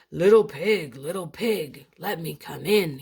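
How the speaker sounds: loud wolf growl at the door; lightly growled wolf demand. Acted. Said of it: "he growled".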